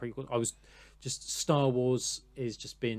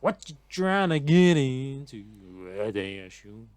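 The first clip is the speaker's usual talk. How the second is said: playful sing-song imitation; 7 dB louder than usual. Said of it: "they go".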